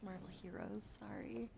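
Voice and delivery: ordinary talk, nothing marked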